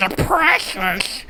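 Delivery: slow hissing goblin voice; drawn-out creepy creature whisper; Gollum voice. Pretend. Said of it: "You're afraid to do a goblin voice?".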